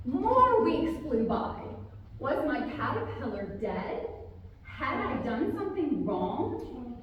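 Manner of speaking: ordinary delivery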